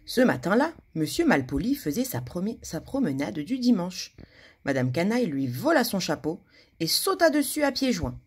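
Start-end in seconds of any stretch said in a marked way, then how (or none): none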